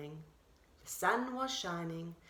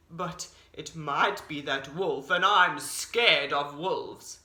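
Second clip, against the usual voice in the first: low scared bear; 7 semitones lower, 4 dB louder, slower than usual. A narrator's reading.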